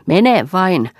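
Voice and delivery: loud, high mock-send-off shout; shouting after him. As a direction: fling it after him as he leaves. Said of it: "huusi hän".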